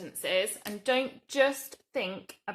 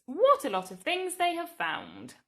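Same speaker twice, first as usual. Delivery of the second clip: higher and louder, full of wonder; exclaimed; 7 semitones higher, 4 dB louder than usual. Shown as a demonstration. Teacher rereading the example sentence to children, amazed. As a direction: sound amazed at all the things. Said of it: "it's an exclamation sentence".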